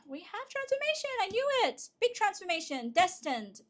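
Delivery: high-pitched, thrilled reveal; excited. Real, not acted. Tarot reader seeing big transformation in the cards, excited. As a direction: react with rising excitement, pitched well up. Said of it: "I'm so excited".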